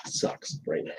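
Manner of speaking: unvoiced whisper on a blunt verdict. Real, not acted. Confiding blunt opinion.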